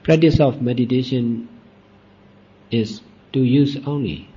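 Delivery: ordinary delivery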